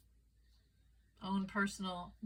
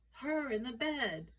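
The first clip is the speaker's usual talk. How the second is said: high, slow, emphatic mock indignation; acted wifely exasperation, pitched up; accusing wife; 5 semitones higher, slower than usual. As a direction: stretch it out as the wronged wife. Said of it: "And the wife goes".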